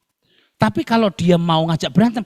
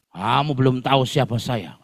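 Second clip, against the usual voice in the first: ordinary talk, nothing marked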